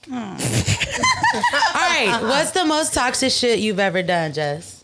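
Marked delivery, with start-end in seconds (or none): 0.2-1.7 high shrieking laugh. Real, not acted. Burst of laughter.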